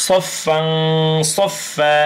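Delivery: nasal hum on ফাং, open long vowel on ফা; measured "saffang saffaa", stretching both endings; recitation demo. Shown as a demonstration. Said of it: "তাহলে কি হচ্ছে উচ্চারণ".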